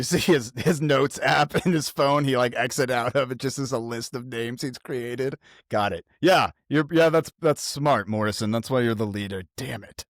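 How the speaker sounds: ordinary talk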